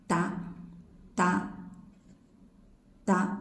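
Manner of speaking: voiced "da" with no breath; unaspirated da. Shown as a demonstration. Teacher demonstrating voiced da. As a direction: voice the da, no puff. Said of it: "Without breath".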